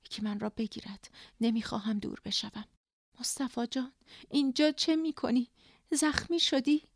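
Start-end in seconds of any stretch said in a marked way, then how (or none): 3.2-6.8 high, startled questioning; anxious surprise. A narrator's reading. Wife seeing Mustafa in her delirium, anxious surprise. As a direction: ask in worried disbelief.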